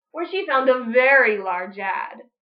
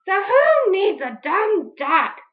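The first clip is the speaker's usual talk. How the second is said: slow, loud sulky scoff; huffy witch; 6 semitones higher, 6 dB louder, slower than usual. Acted. Witch Grinch sulking after the duck leaves. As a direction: shrug it off sourly. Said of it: "said Grinch".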